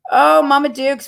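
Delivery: a high, loud happy oh at the sight of Mama Dukes; greeting Mama Dukes on screen with a loud, high, happy call; surprised delight. Real, not acted. Spots someone on screen.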